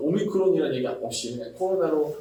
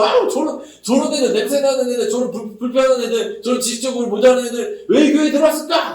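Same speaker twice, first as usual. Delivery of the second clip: much higher, building to a disgusted shout; grumbling sneer; 7 semitones higher, 4 dB louder than usual. Retold or imitated. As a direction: spit out the list of misfits. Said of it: "그러고 막 투덜투덜 거리는데". (속으로 투덜대다 역겹다는 듯 외치는 흉내)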